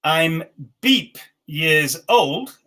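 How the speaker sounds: very slow, each word apart; slow dictation. Shown as a demonstration. Teacher dictating the next sentence slowly. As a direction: drag it out so they can write it. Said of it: "Next one".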